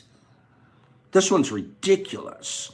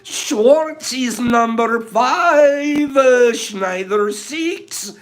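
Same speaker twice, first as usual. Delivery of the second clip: slow, drawn-out, loud high announcer; 8 semitones higher, 5 dB louder, much slower than usual. Pretend. Announcing the winners to the stream, grand. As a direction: call each name like a game show host. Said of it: "announcing names slowly, grandly".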